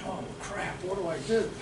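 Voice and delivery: hushed, sinking inner voice; quiet dismay. Retold or imitated. Buyer sitting there without the money, hushed dismay. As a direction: say it softly, as if to yourself.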